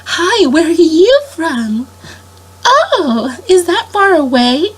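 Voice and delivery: high, slow, sweet princess greeting. Retold or imitated. Princesses greeting guests at the table, sweet and high. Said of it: "they're like".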